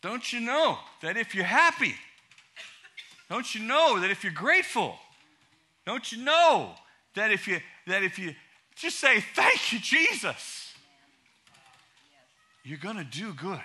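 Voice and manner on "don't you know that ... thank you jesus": rising preaching. Acted. Preaching with force.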